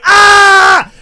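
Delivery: long high scream of burning pain. Retold or imitated. Comic retelling how peeing burned, pained scream. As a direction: yell out as if it burns.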